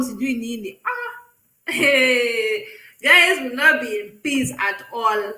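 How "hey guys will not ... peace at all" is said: ordinary talk, nothing marked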